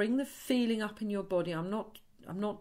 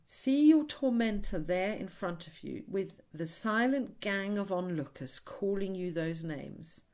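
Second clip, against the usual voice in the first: ordinary talk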